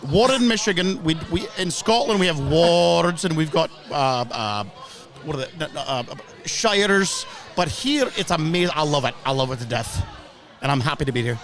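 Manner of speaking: put-on Scottish accent, rolled R's. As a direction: stay in the Glasgow fan character. Holds put-on Scottish fan voice.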